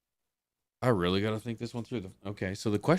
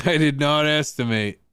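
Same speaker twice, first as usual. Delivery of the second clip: louder, higher emphatic declaration; raised voice, falling at the end; emphatic repeat; 5 semitones higher, 6 dB louder than usual. Real, not acted. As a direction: punch out "did not" high and loud.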